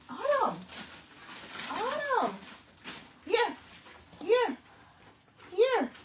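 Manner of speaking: high sing-song call. Real, not acted. Calling toddler over.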